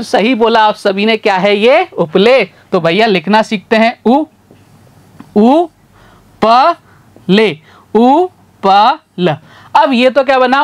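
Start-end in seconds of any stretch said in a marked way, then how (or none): none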